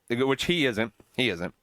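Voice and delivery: ordinary talk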